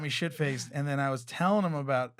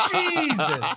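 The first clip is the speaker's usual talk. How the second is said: laughing exclamation; 11 semitones higher, 4 dB louder than usual. Retold or imitated. Reacting to security guard's news.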